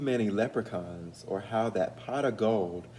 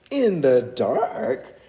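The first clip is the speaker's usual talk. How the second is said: slow, loud, higher shocked cry; cried out in dismay; 4 semitones higher, 7 dB louder, much slower than usual. Acted. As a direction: wail it in hurt disbelief. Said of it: "cried Waddle".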